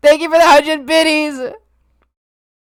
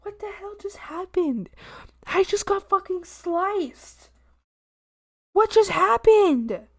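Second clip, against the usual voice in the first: stunned disbelief; 3 semitones higher, 6 dB quieter than usual. Real, not acted. Streamer just got sliced in game, hushed disbelief.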